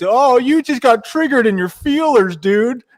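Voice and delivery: mocking impression. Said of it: "what am I gonna do saying it to his face?".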